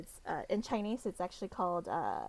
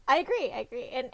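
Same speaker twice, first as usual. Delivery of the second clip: laughing; 5 semitones higher, 6 dB louder than usual. Real, not acted. Laughing agreement with co-host.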